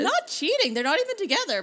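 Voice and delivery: high, loud objection; exasperated protest. Acted. Arguing the heroine was not cheating, exasperated protest. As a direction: protest loudly, pitch jumping up.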